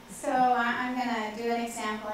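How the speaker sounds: ordinary talk, nothing marked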